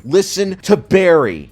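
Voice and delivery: emphatic repeat. Real, not acted. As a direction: drag out "Listen to Barry" as a loud demand.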